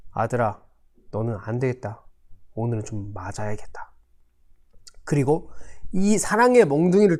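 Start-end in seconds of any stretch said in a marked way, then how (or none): 0.1-3.9 low, quiet, resolved voice; grave stern father. Acted. Father summoning his son for punishment, low and grave. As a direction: lower and soften it into a solemn verdict.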